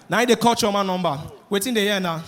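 ordinary talk

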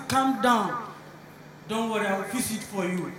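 low, soft rich-man composure; unruffled, hushed placating; calm reassurance. Acted. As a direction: soothe the shouting driver quietly. Rich man placates the angry driver.

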